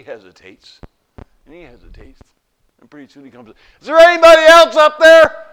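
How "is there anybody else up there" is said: very loud, high hopeful yell; desperate shout. Acted. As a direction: scream for someone else to answer.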